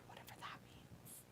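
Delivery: whisper. Real, not acted. Whispered aside.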